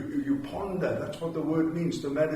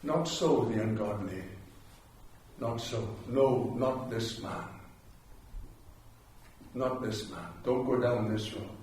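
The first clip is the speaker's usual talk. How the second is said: solemn hush; 5 semitones lower, 5 dB quieter than usual. Acted. Quiet solemn close.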